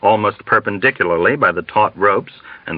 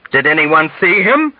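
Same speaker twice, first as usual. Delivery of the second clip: high, challenging demand; fervent, mocking challenge; sneering fervor; 7 semitones higher than usual. A narrator's reading. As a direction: demand it with scornful intensity. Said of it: "demanded with sneering fervor".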